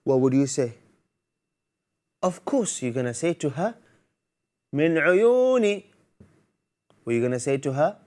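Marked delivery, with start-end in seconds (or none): 4.7-5.8 willing reply. Pretend. Child's happy answer to mom.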